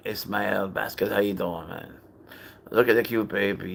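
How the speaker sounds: usual speaking voice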